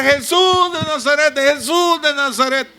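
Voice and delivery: high, calling out "Jesús de Nazaret" from the roadside; a beggar's high-pitched call for Jesus, imitated; blind man crying out. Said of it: "empezó a gritar".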